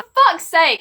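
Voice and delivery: high, loud exasperation; exasperated outburst. Real, not acted. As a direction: throw it out fed up. Exasperated at boyfriend.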